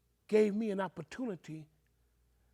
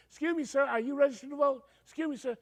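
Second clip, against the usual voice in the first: polite canvasser voice; 8 semitones higher, 4 dB louder, faster than usual. Retold or imitated. Canvassers at grocery store asking.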